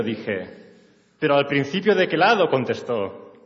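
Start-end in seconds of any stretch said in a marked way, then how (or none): 1.2-2.5 faster, louder, higher, pressing the question; impatient operator. Acted. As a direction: push her like a fed-up operator. Operator asks again, impatient.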